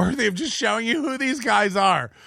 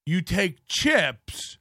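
ordinary talk, nothing marked

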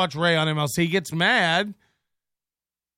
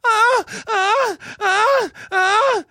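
high, loud wailing ah; mom crying wail; 5 dB louder, much slower than usual. Retold or imitated. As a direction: wail like a sobbing mother. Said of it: "Sound just like my mom".

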